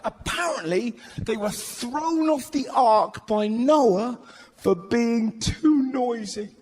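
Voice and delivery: ordinary talk, nothing marked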